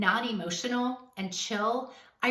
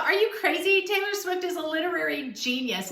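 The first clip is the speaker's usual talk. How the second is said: retold outburst, pitched up in disbelief; incredulous retort; 5 semitones higher than usual. Coach recalling her shock at a girl's opinion, incredulous.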